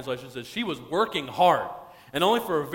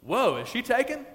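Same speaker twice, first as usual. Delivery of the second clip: high, interested double-take; acted smitten curiosity; intrigued surprise; 7 semitones higher than usual. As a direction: jump up on "Whoa", then ask eagerly. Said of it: "In the original language, he's going".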